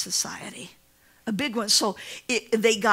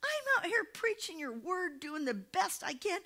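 high, soft indignant protest; mock outraged complaint; indignant preacher; 9 semitones higher, 9 dB quieter, slower than usual. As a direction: act wounded and startled. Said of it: "And he said".

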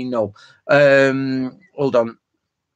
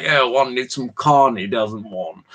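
posh British; slower than usual. Retold or imitated. Samurai as posh British gent asking for crab, posh voice. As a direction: play the grand samurai, plummy and lofty. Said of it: "imagine a really posh British person".

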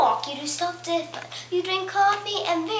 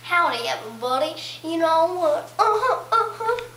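put-on Texas accent; 3 dB louder than usual. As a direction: play a cheerful Texan greeting the room. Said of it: "I'll be Texas".

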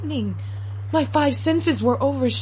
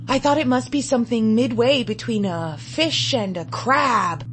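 ordinary delivery

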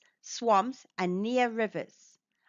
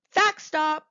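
higher and louder announcement; excited callout; 5 semitones higher, 5 dB louder than usual. Acted. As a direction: call it out like a fun game signal. Announcing a fact break heading.

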